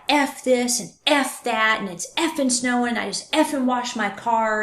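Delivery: normal talking voice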